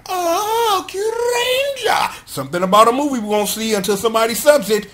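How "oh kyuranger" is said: very high, drawn-out hero cry; hero title call. Shown as a demonstration. Podcaster doing the show's title for listeners, hammed-up hero call. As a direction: stretch it out like a theme-song shout. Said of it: "I gotta do this for you".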